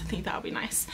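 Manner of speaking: soft whispered aside. Real, not acted. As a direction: breathe it as a quiet aside. Unvoiced aside.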